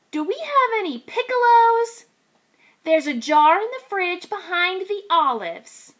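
lightly voiced piglet then grandma; piglet asking. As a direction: ask brightly like a small kid. Kids asking, grandma answers.

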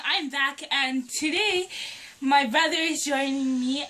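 ordinary talk

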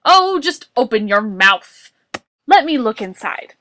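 loud, exasperated order; Boris. A narrator's reading. Boris examining Morris's sore throat, shouting. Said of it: "Boris shouted".

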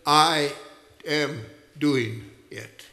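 I hit loud, then am doing it slow; preacher voicing Jesus, slow and firm; emphatic I. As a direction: land the final claim with weight. Quoting Jesus, building to climax.

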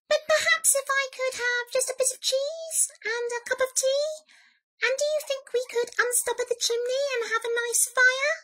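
high, polite mouse voice; little mouse. A narrator's reading. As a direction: squeak a hopeful, dainty request. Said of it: "said the mouse".

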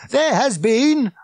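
slow, loud, high public announcement; drawn-out shouted proclamation; megaphone bark. A narrator's reading. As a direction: blare it out over a crowd. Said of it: "barked the megaphone".

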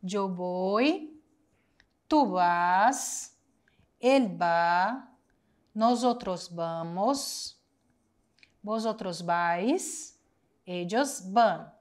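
each form said slowly, with a rise; slow conjugation drill. Shown as a demonstration. Teacher reciting verb forms slowly to the class. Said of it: "Verbo ir no futuro".

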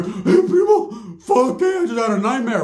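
high, startled voice waking from a nightmare; hammed-up fright of a tough guy; mock panicky cousin. Cousin waking his cousin after a nightmare, mock frightened.

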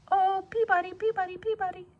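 high whistled birdsong voice; sparrow call. A narrator's reading. Narrator mimics the sparrow's call, high and whistled. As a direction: sing it like a sparrow calling.

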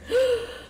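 sharp high gasp; shocked gasp. Real, not acted. Streamer gasping as the husband cheats. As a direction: suck in air in shock.

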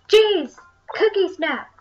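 imitating viewers yelling at the screen; high, loud exasperated mock shout. Gamer voicing viewers who know the game's name, mock yelling. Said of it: "I'm yelling at you".

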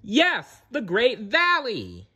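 very high, drawn-out agreement; excited echo. A narrator's reading. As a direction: echo the cry eagerly, pitched right up. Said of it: "echoed Sarah".

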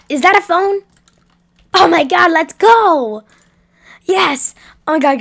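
high, loud, thrilled; excited. Acted. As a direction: shout with joy. Finding a phone.

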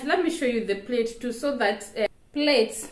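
ordinary talk, nothing marked

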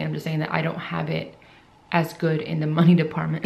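ordinary talk

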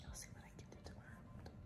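soft whisper in a quiet room; library whisper. Real, not acted. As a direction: whisper so nobody nearby hears. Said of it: "Whispering 'cause I know people can hear me".

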